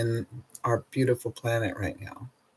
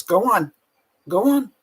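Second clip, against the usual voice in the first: high, bright coaxing to a dog, louder; 10 semitones higher, 5 dB louder than usual. Real, not acted. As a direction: shoo the dog out, much higher and louder. Talking to her dog again.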